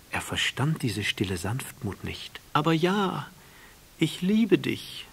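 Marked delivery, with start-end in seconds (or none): 2.5-5.1 higher, louder, slow loving admission; a proud flower finally admitting love; flower's confession. A narrator's reading. The flower confessing her love to the prince, higher and slow.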